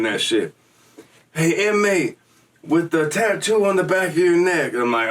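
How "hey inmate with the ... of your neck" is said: high, calling guard over the intercom. Retold or imitated. Prison guard calls out to the inmate walking away, high calling voice. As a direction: bark it out like a guard on the speaker.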